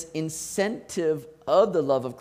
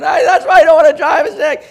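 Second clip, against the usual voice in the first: imitating a panicked daughter, high and loud with a laugh; laughing squeal of a learner driver freaking out; 9 semitones higher, 13 dB louder than usual. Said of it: "she's freaking out".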